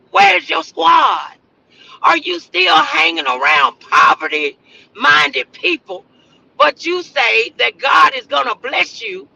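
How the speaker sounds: ordinary talk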